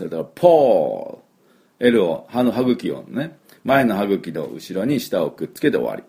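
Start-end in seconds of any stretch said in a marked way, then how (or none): 0.2-1.9 ポール said slowly, ending on the tongue touching the gum ridge; ポール stretched, with a clean L and no U at the end; slow demo with L ending. Shown as a demonstration. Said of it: "ここで終わります". (ポールをゆっくり、最後はLで止める)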